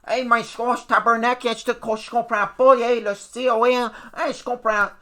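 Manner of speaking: high, loud, swearing Quebec pundit rant; mock him like a foul-mouthed sailor, way up high and loud. Vlogger mocking the TV pundit's rough French, high loud mock rant. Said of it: "He talks like a sailor".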